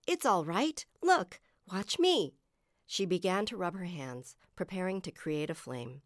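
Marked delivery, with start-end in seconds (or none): 0.1-2.3 high, raised reassuring call. A narrator's reading. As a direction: call up encouragement to a friend. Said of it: "Uma called".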